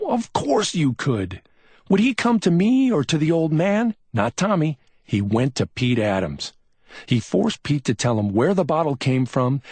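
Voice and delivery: a mild hint of bitter certainty; lightly voiced detective. A narrator's reading. McMain explains Tommy's sleuthing, confident.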